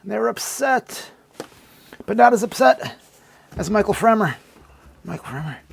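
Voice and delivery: ordinary talk, nothing marked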